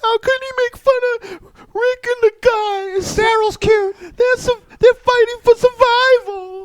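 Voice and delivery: high, loud whiny wail; crying fan voice. Retold or imitated. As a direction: sob it out like a crybaby fan. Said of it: "crawl under their desks and start crying".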